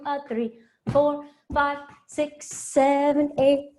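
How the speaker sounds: counting the steps out in sung rhythm, rising; rhythmic dance count. Acted. Dance teacher counting the class through the steps in rhythm. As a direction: call the beats to the class.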